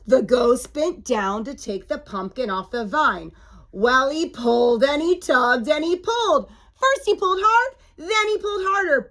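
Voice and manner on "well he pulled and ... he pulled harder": climbing higher with each pull; animated storybook build-up; building excitement. A narrator's reading. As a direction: narrate a struggle with mounting effort. Narrating a mounting tug of war.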